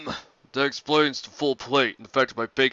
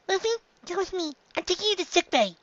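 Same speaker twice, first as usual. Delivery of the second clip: much higher, squeaky small doctor; high little Chopper. Acted. Chopper answering Sanji about Luffy, high squeaky voice. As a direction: squeak it like a tiny reindeer.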